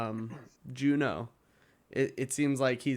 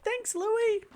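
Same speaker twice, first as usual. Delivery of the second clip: put-on very high, sweet girl voice; squeaky high voice; 3 dB louder than usual. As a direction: chirp it brightly. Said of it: "she just said".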